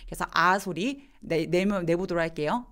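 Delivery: usual speaking voice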